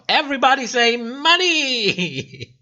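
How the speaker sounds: high, bright rallying cry to the chat; hype call-out. Pretend. Streamer rallies the viewers to shout money, excited call-out. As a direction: get the whole chat chanting along.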